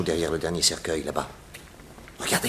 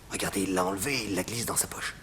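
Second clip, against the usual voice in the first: whisper; 5 dB quieter than usual. Acted. Hiding, watching the thief.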